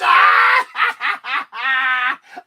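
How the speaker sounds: high, loud shrieking laugh; screaming laugh. Pretend. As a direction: cackle while screaming. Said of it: "try to scream as loud as I can".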